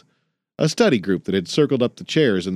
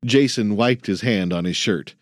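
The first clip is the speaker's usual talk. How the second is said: ordinary delivery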